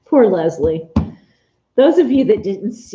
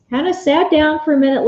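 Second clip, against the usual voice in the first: normal talking voice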